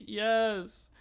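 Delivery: high-pitched mock proclamation; imitated contestant. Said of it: "So the girls are like".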